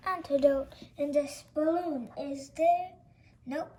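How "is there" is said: usual speaking voice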